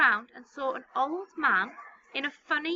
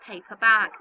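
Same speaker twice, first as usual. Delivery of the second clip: usual speaking voice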